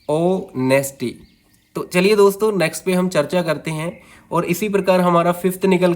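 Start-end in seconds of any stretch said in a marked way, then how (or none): 0.1-1.2 honesty in slow syllables, weight on ऑ; long ऑ, then नेस and टी apart; stress on first syllable. Shown as a demonstration. Teacher giving a dactyl example, slow stress on ऑ in honesty. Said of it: "पहला दोस्तों stressed होता है".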